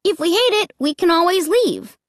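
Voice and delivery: high-pitched persuasive reasoning; keen child coaxing parents; urging. A narrator's reading. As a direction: talk them round eagerly, pitch up. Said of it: "I urged them".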